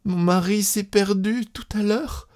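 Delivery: high-pitched tearful woman's voice; tearful stammer. A narrator's reading. Wife telling her cousin her husband is lost, tearful. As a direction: blurt it out high, near tears. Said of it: "Elle balbutia, les yeux pleins de larmes".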